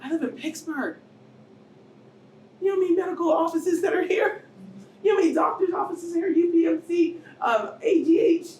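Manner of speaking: high, loud, exasperated shouting; frustrated outcry. Retold or imitated. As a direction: rail against it, voice climbing. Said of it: "I kept telling myself".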